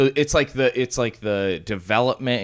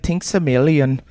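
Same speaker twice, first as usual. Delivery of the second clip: put-on shitty Irish accent; 6 semitones higher, 5 dB louder than usual. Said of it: "shitty Irish accent".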